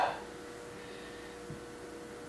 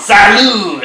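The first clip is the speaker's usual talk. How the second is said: loud, high French "Salut!" toast; French cheer; 8 semitones higher, 9 dB louder than usual. Pretend.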